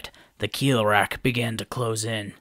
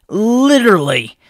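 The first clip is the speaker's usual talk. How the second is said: mocking; 11 semitones higher, 12 dB louder than usual. A narrator's reading. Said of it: "Matau said mockingly".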